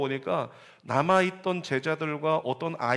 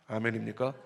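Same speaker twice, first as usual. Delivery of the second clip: normal talking voice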